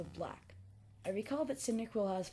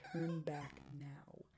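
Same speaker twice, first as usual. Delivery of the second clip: slow, low eerie whisper; hushed creeping horror; 4 semitones lower, 6 dB quieter, much slower than usual. Acted. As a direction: breathe the warning slowly like a ghost. Sign text in creepy story.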